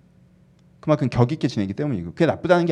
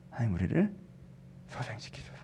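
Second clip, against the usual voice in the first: very soft, lower plea; whispered prayer; 4 semitones lower, 15 dB quieter than usual. Acted. Praying to God to revive us, whispered. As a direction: let it sink into a breath of prayer.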